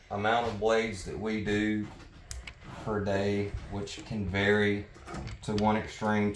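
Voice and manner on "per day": ordinary talk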